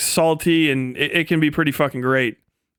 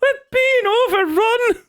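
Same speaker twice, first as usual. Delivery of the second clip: put-on alarmed old Irishman, laughing; slower than usual. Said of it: "the old man".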